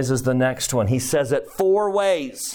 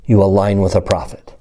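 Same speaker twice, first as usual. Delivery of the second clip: louder sermon voice; lower, louder preaching; preaching at full force; 6 semitones lower, 9 dB louder than usual. Acted. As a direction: drive the point home to the congregation.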